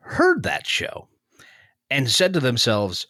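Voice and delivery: ordinary talk, nothing marked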